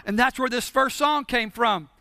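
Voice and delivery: usual speaking voice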